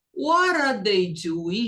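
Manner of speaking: "What are" run together as one word in a slow question; linked words. Shown as a demonstration. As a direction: link "What are" into "they doing". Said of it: "Junta aqui". ("What are" juntado numa palavra só, devagar)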